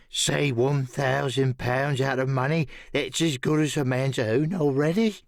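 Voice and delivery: lightly voiced sly castaway; a hint of a bargaining hermit; Ben Gunn. A narrator's reading.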